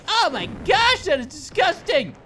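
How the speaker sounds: loud, high mock disgust; mock disgusted shout. Teammates yelling about fart bombs, mock disgust. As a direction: yell it like the smell is awful. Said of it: "everyone's like".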